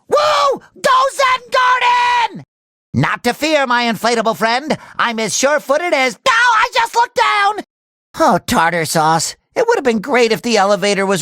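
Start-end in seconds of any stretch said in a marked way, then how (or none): 0.1-2.4 high, drawn-out whooping cheer; excited cheer. Acted. Cheering on Zen Garden. 6.2-7.6 high yelp of sudden fright; panicked cry. Acted.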